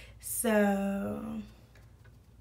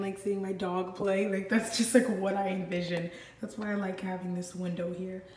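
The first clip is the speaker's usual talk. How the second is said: ordinary talk, nothing marked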